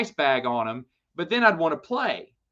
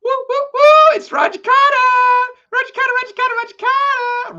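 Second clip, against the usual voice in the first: high, loud hooting hype chant; excited whooping cheer; 10 dB louder than usual. Real, not acted. Greeting an old roommate, Roger, with an excited whooping cheer.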